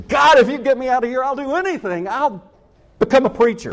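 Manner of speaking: high pleading cry to God; desperate prayer. Retold or imitated. Captured soldier bargaining with God, high desperate plea.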